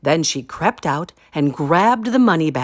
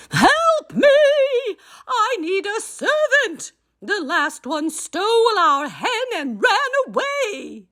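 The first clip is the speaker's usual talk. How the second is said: giantess roaring. A narrator's reading. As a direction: shriek for help like a frantic giantess. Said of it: "she roared".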